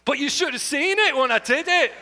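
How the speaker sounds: Scottish accent again. Shown as a demonstration. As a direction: repeat the Scottish phrase. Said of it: "that the only phrase".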